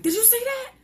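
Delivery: high, alarmed question; startled. Retold or imitated. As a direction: jump out of your skin asking. Startled by something moving.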